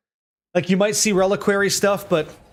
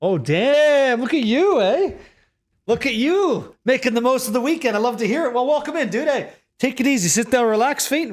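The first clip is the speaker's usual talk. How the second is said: excited greeting; 6 semitones higher than usual. Real, not acted. Greeting a viewer joining stream.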